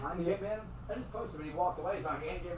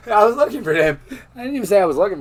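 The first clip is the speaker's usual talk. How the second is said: words through real laughter, louder; laughing; 6 semitones higher, 16 dB louder than usual. Real, not acted. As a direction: laugh it out at the absurdity. Laughing at the absurd memory.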